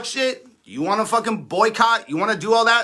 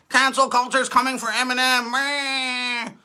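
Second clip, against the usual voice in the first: a higher, whiny imitation of fans crying online; mock the fans' tearful complaint, a touch pitched up; 5 semitones higher than usual. Mocking [name] fans who cried about cancel culture, whiny and higher.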